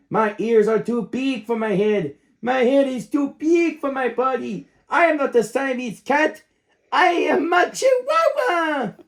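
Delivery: put-on Spanish accent building to a shout; big Spanish accent. Puppy declares himself a Chihuahua in a big Spanish accent. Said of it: "using his very big Spanish accent".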